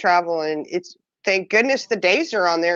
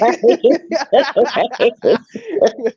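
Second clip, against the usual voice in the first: bursting into real laughter, higher and louder; laughing; 5 semitones higher, 6 dB louder than usual. Real, not acted. Laughing with friends at a joke about medications. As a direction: let a full laugh roll out.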